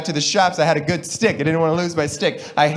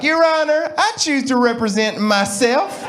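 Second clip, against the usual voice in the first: put-on high Southern accent, drawn out at the end; hammed-up Southern courtroom address; 9 semitones higher than usual. Defendant to the judge, high mock Southern accent. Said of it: "Southern accent's a little bit more like".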